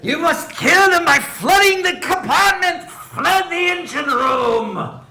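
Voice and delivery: high, loud and slow ominous order; eerie command. Acted. Possessed flute commanding the player, eerie. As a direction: intone the command like a spirit. GM voices creepy commanding NPC.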